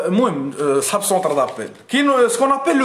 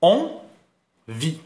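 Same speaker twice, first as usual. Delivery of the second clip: very slow, syllable by syllable اونفي; اونفي said slowly, each syllable apart; split into syllables; 4 semitones lower, much slower than usual. Shown as a demonstration. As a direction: stretch out اونفي one syllable at a time. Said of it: "شحال فيها من سيلاب".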